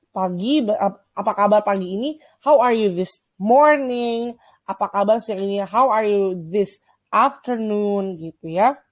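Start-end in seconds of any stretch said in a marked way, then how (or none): 2.4-4.3 slow model reading. Shown as a demonstration. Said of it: "teacher models greeting". 5.6-8.2 slow model reading. Shown as a demonstration. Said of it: "teacher models greeting".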